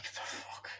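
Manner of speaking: voiceless, barely audible whisper; whispered disbelief. Retold or imitated. Viewer reacting to the nightclub scene, whispered disbelief.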